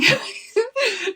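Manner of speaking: high, breathy cackle of embarrassment; high laughing squeal. Real, not acted. Recalling what she was thinking, high embarrassed laugh. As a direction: burst out laughing at yourself. Laughing at her past choice.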